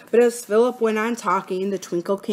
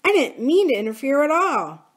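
higher-pitched, awkward excuse; 7 semitones higher, 3 dB louder than usual. A narrator's reading. Boy explains himself to Bill, awkward. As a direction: excuse yourself uneasily. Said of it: "he said awkwardly".

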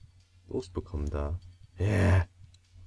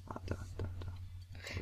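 whisper; 13 dB quieter than usual. Real, not acted. Whispering during surgery game.

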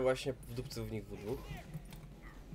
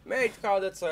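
excited; 10 semitones higher, 7 dB louder than usual. Real, not acted. Excited game callout.

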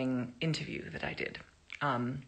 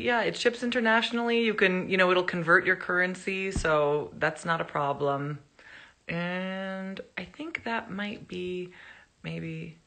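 normal talking voice

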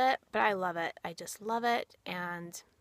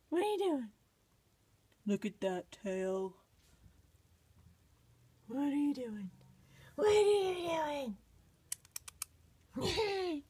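put-on baby talk to pets; 5 semitones higher, 3 dB quieter than usual. Owner asking her playful bunny in high baby talk. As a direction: tease the bunny softly up high. Talking to rabbit.

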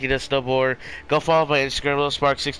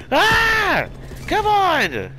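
high, loud angry shout; rage scream; 4 dB louder, much slower than usual. Real, not acted. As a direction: scream in frustration.

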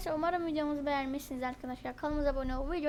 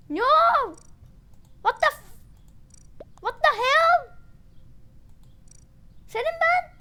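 much higher, loud disbelieving yelp; shocked shriek; 9 semitones higher, 7 dB louder, slower than usual. Real, not acted. Gamer yelping in shock at friends who voted him out. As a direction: scream in outrage at the betrayal. Outraged after being ejected.

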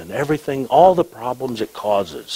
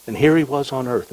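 ordinary delivery

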